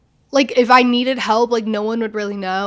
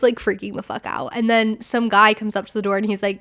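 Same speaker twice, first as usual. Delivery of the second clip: ordinary talk, nothing marked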